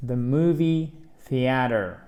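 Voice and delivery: "The movie theater" slow, with the T softened to a d; flapped T. Shown as a demonstration. Teacher modelling the flapped American T. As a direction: say "theater" like "theader". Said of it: "presque prononcé comme un de".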